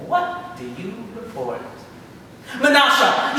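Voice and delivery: lightly voiced demon questioning; a hint of a mocking devil; Satan voice. Satan asks the grandchildren.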